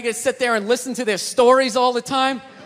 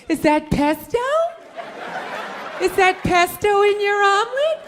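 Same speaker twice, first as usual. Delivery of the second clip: vapid brunch friends; 7 semitones higher, slower than usual. Retold or imitated.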